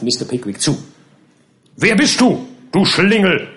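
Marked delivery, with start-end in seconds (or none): 1.8-3.5 much higher, louder furious shout; shouting captain. Acted. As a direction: yell at the drunk in the barrow. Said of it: "rief er". (den Landstreicher anbrüllen)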